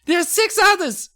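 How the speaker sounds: very high little gnome voice; squeaky gnome. Acted. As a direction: chirp it like a tiny gnome. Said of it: "Hap explained".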